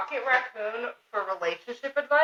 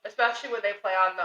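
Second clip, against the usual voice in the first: usual speaking voice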